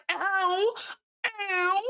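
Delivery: high, squeaky imitation of Yaz yelping; mock pained ow. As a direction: squeal a mock ow like the hurt girl. Mimicking Yaz crying out in pain.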